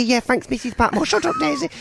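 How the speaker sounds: high, bossy Downton cook; Mrs Patmore voice. Retold or imitated. Mrs Patmore brushing off Daisy, high and bossy. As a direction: switch to a sharp, high kitchen cook. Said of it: "she would be talking to Daisy".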